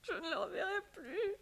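crying. Acted. She weeps for the departed lover.

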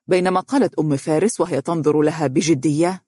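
ordinary delivery, nothing marked

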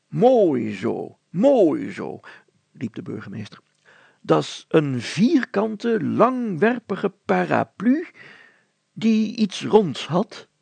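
mayor triumphantly confused; self-satisfied mayor. Acted. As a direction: proudly announce nonsense. Mayor concludes absurdly.

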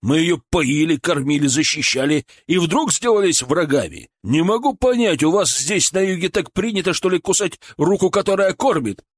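возмущение, растущее к высокому тону; упрекать всё выше, горячась; негодование. Acted. Николай в ссоре негодует. (возмущённо, всё более горячась)

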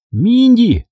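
high, sudden excited call; crying out. A narrator's reading. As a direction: call out to a sister, pitched high. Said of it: "закричал я". (взволнованный крик сестре)

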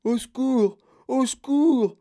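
building, high-pitched distress calls; bawling for help. A narrator's reading. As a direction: bawl out for rescue, stretching the last one. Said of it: "brailla-t-il".

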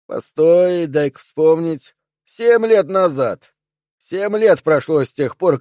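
jab at the boss, slowing down on the years; sarcastic old soldier. Acted. Dew voiced, sarcastic.

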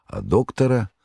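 usual speaking voice

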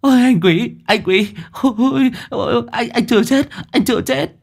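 hysterical, breathless relief; frantic panic. Acted. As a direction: babble in shocked panic. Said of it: "hốt hoảng".

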